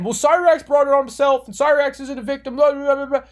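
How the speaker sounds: sneering impression of commenters excusing it; mock critics. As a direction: parody the people brushing it off. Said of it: "people might say".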